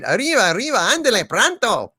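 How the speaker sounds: lightly voiced Speedy Gonzalez. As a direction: shout it like the cartoon mouse. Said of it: "Speedy Gonzalez".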